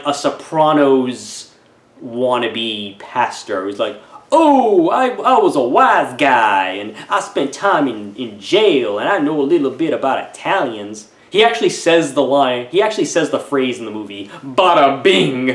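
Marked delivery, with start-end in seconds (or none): 4.3-11.1 loud New York wiseguy, hammed up; mobster voice. Mobster pastor voiced in review. 14.4-15.5 mobster voice. Retold or imitated. Quoting mobster pastor line.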